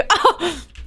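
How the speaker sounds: a short high laugh of self-delight; happy giggle; proud laugh. Real, not acted. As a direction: laugh at getting the word right. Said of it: "Look at me".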